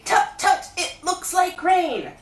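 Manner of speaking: high, slowed bear voice saying it out loud; plain Pooh voice. A narrator's reading. Pooh showing Christopher Robin what to say, voiced aloud. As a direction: Pooh voicing his line aloud.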